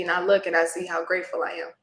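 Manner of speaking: normal talking voice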